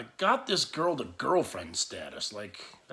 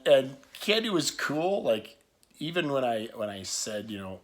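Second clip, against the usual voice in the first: ordinary talk, nothing marked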